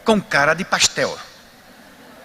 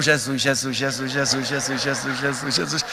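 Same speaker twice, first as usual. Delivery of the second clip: low, soft repeated prayer; murmured mantra; 6 semitones lower, 5 dB quieter than usual. Retold or imitated. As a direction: keep chanting the name under the breath. Mantra continues.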